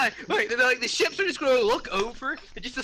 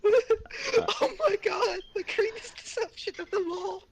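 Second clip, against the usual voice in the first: laughing cry; 9 semitones higher than usual. Real, not acted. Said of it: "Goblin scream".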